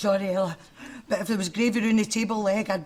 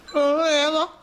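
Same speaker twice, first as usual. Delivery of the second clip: laughing; 7 semitones higher, 4 dB louder than usual. Acted. Laughing despair to friend.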